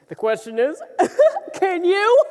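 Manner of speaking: high, loud laughter round the joke; teasing the queen while laughing; laughing tease. Acted. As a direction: crack up at your own joke.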